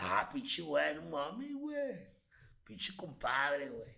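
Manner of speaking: loud, high northern Mexican accent, drawn out; hammed-up Sonora accent from Hermosillo; exaggerated northern Mexican accent, stretched slow on compadre. Shown as a demonstration. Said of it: "Muéstranos".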